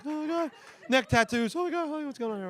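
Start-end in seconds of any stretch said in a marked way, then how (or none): 0.0-0.5 high, hushed "oh my God"; mock alarm. Acts panicked reaction driving through Camden. 1.6-2.5 high, soft, nervous play-acting; mock alarm. Acts panicked reaction driving through Camden.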